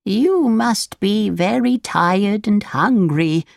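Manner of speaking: put-on drawn-out, caring old woman; old woman, kindly. A narrator's reading. Old woman speaks to tired Pinocchio, slow kindly voice.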